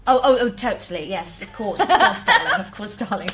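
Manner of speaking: posh British "darling" voice; proper English accent. Acted. Singer switching on request from Icelandic, proper English accent. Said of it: "switch right back to proper English".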